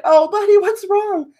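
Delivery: high, gentle comforting tone; soothing mom. Retold or imitated. Mom comforting her sobbing son, high and gentle. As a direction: coax the crying boy kindly. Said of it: "I was like".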